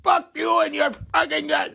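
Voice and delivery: high angry yell at the scammer; mock furious outburst; retold yelling. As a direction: curse him out, voice jumping up. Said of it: "we were screaming and yelling".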